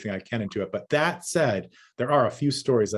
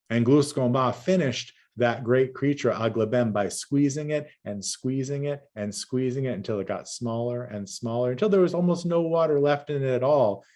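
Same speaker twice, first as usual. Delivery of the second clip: ordinary talk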